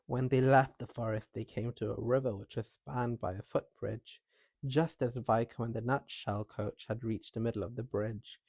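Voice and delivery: ordinary delivery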